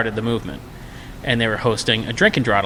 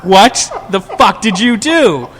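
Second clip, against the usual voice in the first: shocked disbelief; 9 semitones higher, 7 dB louder, slower than usual. Retold or imitated. Shocked at the ripped wallpaper.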